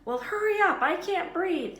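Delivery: loud, high desperate plea; gasping. A narrator's reading. Harry pleads while choked by plant, desperate loud gasp. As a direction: beg through lost breath. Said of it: "Harry gasped".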